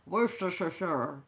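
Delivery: low, slow, slushy Scottish film-star voice; drawn-out, shushy impersonation; Sean Connery. Acted. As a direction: slur every s into sh, low and slow. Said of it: "I'm turning into Sean Connery".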